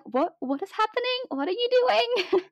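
high-pitched, incredulous retold questions; bewildered. Guest retelling her reaction to a surprise voucher, high and bewildered. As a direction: act out being thrown, voice jumping up. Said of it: "everything was going so quickly".

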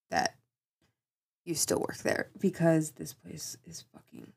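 soft secretive whisper; hushed confession about a crazy workplace. Acted. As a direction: whisper it as if the boss might hear.

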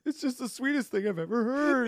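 very high mock-weepy gush; tearful listener. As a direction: squeal it as if moved to tears. Acting out tearful podcast listener.

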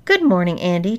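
ordinary delivery

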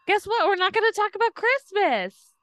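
high, loud teasing announcement; excited. Real, not acted. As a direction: playfully announce it.

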